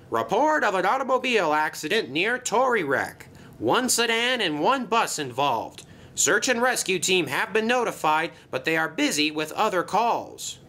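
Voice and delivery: high-pitched dispatcher voice; alarm announcement. Acted. Yard alarm reporting an accident, high announcing voice.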